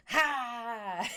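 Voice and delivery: loud, drawn-out breathy "haaa"; playful fire-breathing shout; fiery villain "ha". Acted. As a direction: burst out a big mock-villain "ha". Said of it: "an emote like that with fire".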